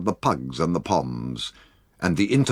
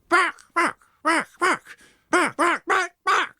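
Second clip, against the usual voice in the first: high, sharp little dog barks; yapping dogs. Acted. Pugs and Poms wanting to join the fray, yapping high barks. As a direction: yap like a tiny angry dog. Peak and Pollicle start barking.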